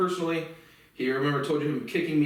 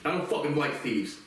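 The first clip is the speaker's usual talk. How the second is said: ordinary talk, nothing marked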